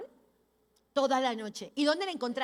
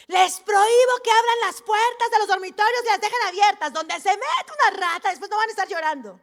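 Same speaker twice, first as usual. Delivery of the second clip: regaño de madre, alto y fuerte; madre severa; 5 semitones higher, 7 dB louder than usual. Retold or imitated. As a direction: imita a una mamá dando una orden tajante. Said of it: "Mi mamá era muy suavecita". (madre que regaña, en voz alta)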